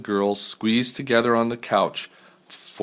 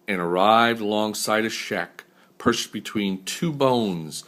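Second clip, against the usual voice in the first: ordinary delivery, nothing marked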